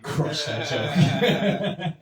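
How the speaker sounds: slow, breaking into laughter while saying it; fighting back laughter, drawn out; laughing. Real, not acted. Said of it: "and I'm like".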